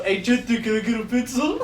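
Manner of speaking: lightly put-on goofy classmate asking a favor; playful impression of a joker; imitating the classmate. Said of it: "I can see that stupid motherfucker saying that".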